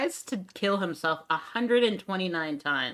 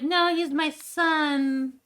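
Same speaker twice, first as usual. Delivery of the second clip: high, slow, drawn-out plea; higher slow mock cry of a mother; mock pleading [name]; 11 semitones higher, 4 dB louder, much slower than usual. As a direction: mimic a desperate mother, pitched up. [name] voiced protecting son.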